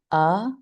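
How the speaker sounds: "uh" as a relaxed, neutral vowel; schwa sound. Shown as a demonstration. Teacher showing the second sound of A, schwa sound. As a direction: say "uh" from the throat with a loose jaw. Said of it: "a little bit more than with".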